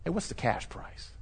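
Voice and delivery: secretive whisper; hushed under-the-table ask; whispered aside. Retold or imitated. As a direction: whisper it like a shady offer. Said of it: "they'll say".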